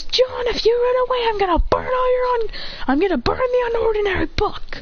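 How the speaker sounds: high-pitched acted threatening; desperate threat. Acted threat to stop John leaving.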